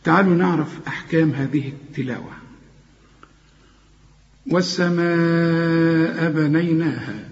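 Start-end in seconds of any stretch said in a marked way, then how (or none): 4.5-7.3 والسماء with the long vowel drawn out slowly; long madd. Shown as a demonstration. Teacher demonstrating the obligatory madd, slow recitation. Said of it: "أنت لاحظت إن أنا بمد".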